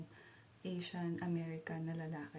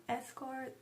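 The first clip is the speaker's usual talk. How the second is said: retold shout; 7 semitones higher than usual. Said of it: "sumigaw na siya".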